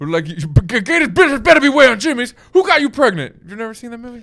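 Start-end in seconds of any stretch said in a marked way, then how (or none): 0.3-3.4 fast, high, loud Gator voice; Gator impression. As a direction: do Will Ferrell's Gator, fast. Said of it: "Will Ferrell as Gator".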